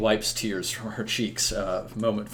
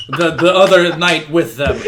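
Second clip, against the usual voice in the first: talking through a laugh, louder and higher; real laughing; 5 semitones higher, 10 dB louder than usual. Real, not acted. Game master forgets a knight's name mid-speech, laughing. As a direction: laugh your way through the fumbled words.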